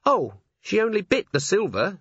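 mildly preoccupied dwarf voice; distracted. A narrator's reading. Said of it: "said Cherie, distractedly".

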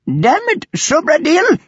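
high-pitched exasperated outburst; fuming. A narrator's reading. Mistress to Subradil over the missing aunt, fuming. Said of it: "Narita fumed".

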